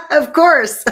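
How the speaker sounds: bursts out laughing, loud and high; a real laugh at the son's bathroom reading. Real, not acted. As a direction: laugh right through the words.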